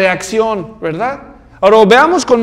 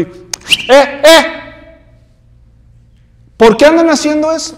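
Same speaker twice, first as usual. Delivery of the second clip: louder, higher scolding call like a policeman; scolding Pharisee; 7 semitones higher, 5 dB louder than usual. Acted. Pharisee scolds the disciples eating grain, loud policing call. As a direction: bark at the disciples like a cop on patrol. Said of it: "estos la traen de policía".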